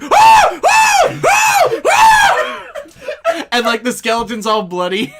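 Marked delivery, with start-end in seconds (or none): 0.0-3.5 shrill screaming at the top of the voice; hammed-up terrified shrieks; screams. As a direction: scream high and loud over and over. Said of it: "he's just like".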